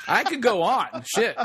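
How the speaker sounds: amused laughing aside. Real, not acted. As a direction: laugh through it at yourself. Laughing after naming many films.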